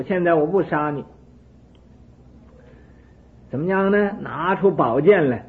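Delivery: loud, high, taunting threats; enraged king daring the hermit; furious king. Acted. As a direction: dare him as you draw the sword.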